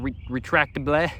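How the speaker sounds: slow, halting French accent. Shown as a demonstration. Vlogger reading the label's French word, attempted French accent. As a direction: sound it out slowly as if French. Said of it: "my French accent".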